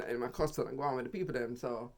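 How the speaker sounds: ordinary delivery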